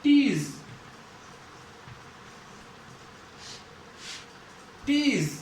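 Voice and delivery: टीज with a long drawn-out ee; long vowel. Shown as a demonstration. Teacher giving a long sound example, long vowel. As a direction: hold the ee in टीज. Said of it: "long sound".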